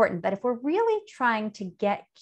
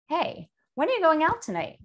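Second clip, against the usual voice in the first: higher, rising friendly question; curious tone; 7 semitones higher than usual. Shown as a demonstration. Teacher contrasts a curious way of asking when. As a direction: ask it with light, open interest. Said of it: "You can hear the difference".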